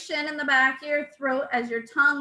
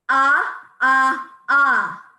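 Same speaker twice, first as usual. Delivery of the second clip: three loud punched ahs from the belly; loud staccato ah; 7 dB louder, much slower than usual. Shown as a demonstration. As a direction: pump each ah out loud and equal. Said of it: "everybody say number one".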